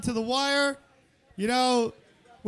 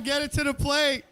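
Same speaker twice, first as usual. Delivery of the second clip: ordinary delivery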